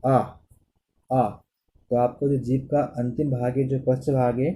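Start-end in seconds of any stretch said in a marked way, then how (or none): 0.0-1.3 आ, आ drawn out slowly; vowel demo. Shown as a demonstration. Teacher demonstrating the back vowel, slow. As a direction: hold आ so the back of the tongue works. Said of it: "जैसे आप बोलें".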